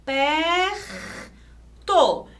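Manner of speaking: "Perto" as PERRR, pause, TO; strong R, split syllables. Shown as a demonstration. Teacher showing R joins first syllable, strong R. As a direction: draw out PE with throaty R, then TO. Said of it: "o som é pronunciado junto".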